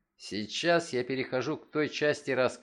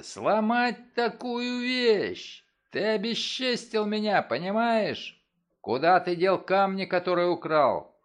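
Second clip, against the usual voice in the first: beside himself with rage; 6 semitones higher, 4 dB louder than usual. A narrator's reading. Father demanding the stolen stones, raging. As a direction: demand answers in a fury. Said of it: "вне себя от ярости".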